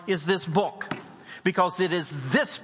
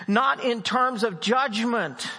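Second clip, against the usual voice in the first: slow, higher, louder preaching; preaching climax; 7 semitones higher, 5 dB louder, much slower than usual. Acted. Preacher urging believers to speak truth, slow and forceful. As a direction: lean hard on each "not".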